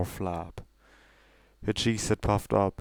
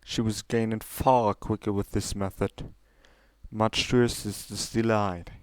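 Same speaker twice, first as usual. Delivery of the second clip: ordinary delivery, nothing marked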